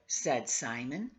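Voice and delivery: ordinary delivery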